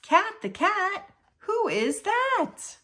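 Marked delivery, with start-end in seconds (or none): none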